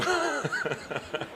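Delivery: short real laugh at himself; amused laugh. Real, not acted. Pastor laughing at his own past hesitation, amused laugh. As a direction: laugh it off, falling away.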